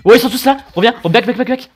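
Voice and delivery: loud, high urgent yelling; frantic shouting. Real, not acted. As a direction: call teammates back in panic. Frantic calls during fight.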